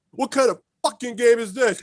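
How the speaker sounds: high indignant outburst; indignant driver. Retold or imitated. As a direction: demand an explanation. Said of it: "He goes".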